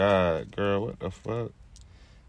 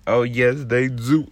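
louder, proud oh yes they do; excited boast; 5 dB louder, slower than usual. Real, not acted. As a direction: crow about it, pleased with herself. Bragging about rolled blunts.

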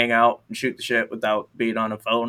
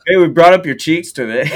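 laughing; 6 semitones higher, 6 dB louder than usual. Pretend. Imitates telling Austin.

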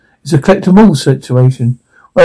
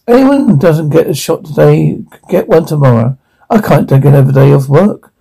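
ordinary talk, nothing marked

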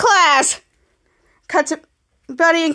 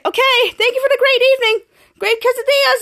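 high polite farewell; 9 semitones higher than usual. Acted. Mika voiced leaving politely.

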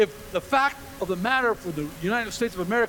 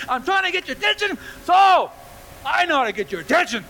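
exasperated God, high-pitched and building to a shout; God scolding; 5 semitones higher than usual. Acted. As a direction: scold them for not listening, voice raised. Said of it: "but if God is saying".

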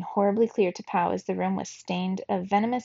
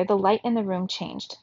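usual speaking voice

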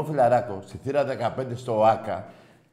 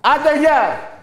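loud, high shout goodbye; dismissive yell; 12 semitones higher, 8 dB louder, much slower than usual. Real, not acted. Host cutting off the Panathinaikos caller with a loud yell. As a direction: bark him off the line.

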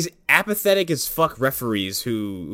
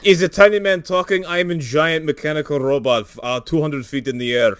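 put-on Russian accent; 3 semitones higher, 3 dB louder than usual. Voicing Alexander mockingly.

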